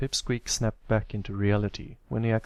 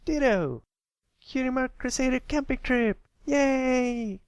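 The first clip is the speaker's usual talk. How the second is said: very high, louder cheer; cheering filly; 3 dB louder than usual. Acted. Sweetie Belle cheering the camping trip, very high and loud. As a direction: burst into a cheer after a pause.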